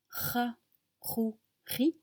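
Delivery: ra, rou, ri with a light scrape in the throat; scraping throat r. Shown as a demonstration. Teacher shows the throat r, lightly scraped. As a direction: rasp the "ra" "rou" "ri" gently in the throat. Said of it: "ça racle un peu la gorge".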